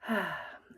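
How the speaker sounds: audible exhale on a sigh; releasing sigh. Shown as a demonstration. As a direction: let the breath go in a sigh of release. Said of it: "as we exhale, we begin to release".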